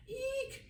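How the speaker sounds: mock frightened squeal, very high; high squealing eek. A narrator's reading. A touch of children's frightened squeal at the snake. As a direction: squeal like scared kids. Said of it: "they shouted".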